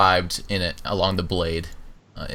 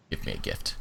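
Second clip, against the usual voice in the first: whispered; 11 semitones lower, 11 dB quieter than usual. Acted. Sister ends demand.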